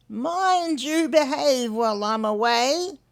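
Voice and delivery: a bilby's affectionate warning; fond Hunwick. Acted. Hunwick leaving the egg.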